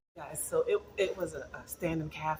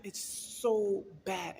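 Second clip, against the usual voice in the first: ordinary delivery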